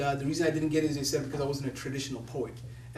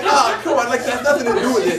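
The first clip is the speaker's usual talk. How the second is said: higher, louder, fast and laughing; laughing protest; 7 semitones higher, 11 dB louder than usual. Retold or imitated. Son protesting to his mom, laughing. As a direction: brush it off, laughing. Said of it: "you're like".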